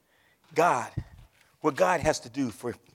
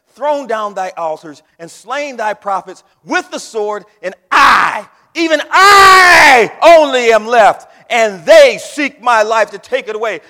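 scripture read slower, then shouted high; building to a shout; 8 semitones higher, 20 dB louder, slower than usual. Acted. Preacher voicing Elijah's lament to the church, rising to a shout. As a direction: swell from a slow loud read into a full shout. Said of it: "you can see the emphasis when I read this".

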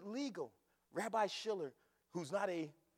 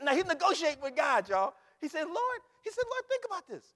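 loud, high exclamation; full-force, high-voiced preacher's outburst; excited preaching; 8 semitones higher, 12 dB louder than usual. Real, not acted. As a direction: shout it to the church, pitched high. Excited preacher explains the bargaining.

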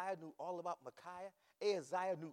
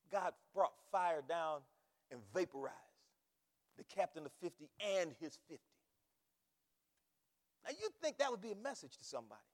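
ordinary talk, nothing marked

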